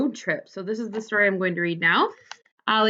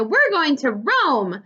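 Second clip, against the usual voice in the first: excited shout; 6 semitones higher, much slower than usual. A narrator's reading. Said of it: "shouted Amelia Bedelia".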